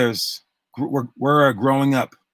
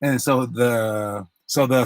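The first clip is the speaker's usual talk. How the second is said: ordinary talk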